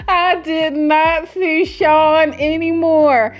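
dramatic disbelief. Real, not acted. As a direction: drag out the punchline in amused disbelief. Dramatic punchline about Sean vanishing.

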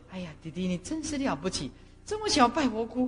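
put-on soft, admiring talk to a little child; retold gentle praise, hushed and impressed; tender praise. (轻声温柔地夸奖小孩)

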